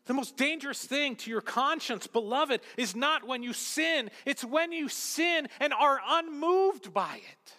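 high, urgent pulpit warning; fervent preaching, slowing on the last phrase; impassioned preaching. Acted. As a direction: drive the warning home to the congregation. Preaches at raised intensity.

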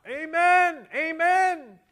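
two high, booming hollers; yelled amens. Retold or imitated. Man interrupting the preacher in Westminster chapel, repeated yelling. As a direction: imitate a heckling churchgoer, yelling it out twice. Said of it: "this guy kept yelling out".

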